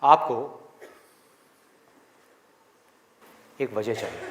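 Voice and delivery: low voice with long pauses between phrases; suspenseful reveal. Real, not acted. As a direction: let each phrase hang in silence. Drawn-out reveal of the answer.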